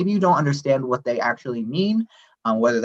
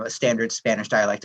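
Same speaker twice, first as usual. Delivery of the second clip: usual speaking voice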